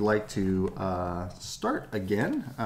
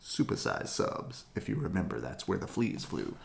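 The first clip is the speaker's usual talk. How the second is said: ordinary delivery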